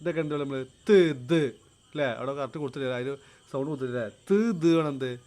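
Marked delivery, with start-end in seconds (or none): 0.9-1.4 ത് said alone, tongue tip on upper front teeth; dental sounds. Shown as a demonstration. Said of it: "dental സൗണ്ടുകൾ". 4.1-4.8 ത് said alone, tongue tip on upper front teeth; dental sounds. Shown as a demonstration. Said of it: "dental സൗണ്ടുകൾ".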